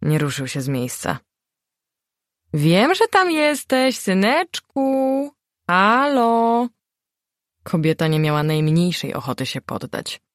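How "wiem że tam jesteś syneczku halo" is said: high, slow sing-song mother's call. A narrator's reading. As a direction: coax the son out from behind the door. Matka woła syna przez drzwi. (wysoki, przeciągły głos matki)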